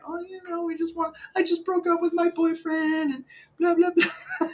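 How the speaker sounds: very high whiny falsetto; mock heartbroken girl. Girl confiding about her breakup, exaggerated mock falsetto. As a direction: imitate an over-sharing girl in squeaky falsetto. Voicing girl answering his pickup line.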